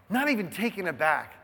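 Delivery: ordinary talk, nothing marked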